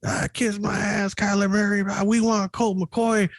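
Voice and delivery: high-pitched mock woman's heckle, slowing down; high squeaky impression of a heckling woman; heckling lady fan. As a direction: mimic a shrill angry lady cussing from the stands. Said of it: "she's just cussing up at Kyler Murray".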